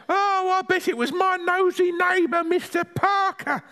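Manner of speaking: put-on very high old granny voice; high-pitched granny griping about a neighbour; Granny figure, grumbling. Granny tells grandson about her nosy neighbour, high-pitched grumbling.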